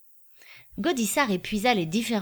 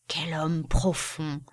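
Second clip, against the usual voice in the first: awed admiration; 2 semitones lower, much slower than usual. A narrator's reading. Gaudissart to Finot about the judge, awed admiration. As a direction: draw out each word with solemn admiration.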